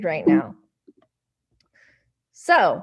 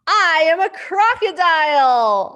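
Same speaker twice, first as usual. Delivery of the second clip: louder triumphant claim; proud announcement; 6 semitones higher, 6 dB louder than usual. Acted. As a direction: declare it proudly. Mouse proudly declares.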